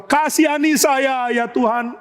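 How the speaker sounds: high, drawn-out acted wail; tearful pleading. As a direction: beg for mercy as if weeping. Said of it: "Daud menangis di hadapan Tuhan".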